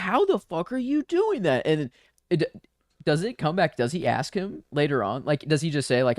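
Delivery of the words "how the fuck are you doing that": much higher, slow astonished question; stunned disbelief. Retold or imitated. Leonis watching Ezra use the Force, in a much higher, slow, astonished voice. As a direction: gape at the impossible trick. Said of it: "He's like".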